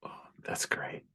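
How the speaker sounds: hushed breathy delight; whispered praise. Real, not acted. Game master whispering praise after a player's pitch. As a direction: whisper it, barely contained.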